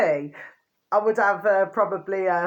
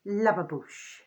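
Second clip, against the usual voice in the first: usual speaking voice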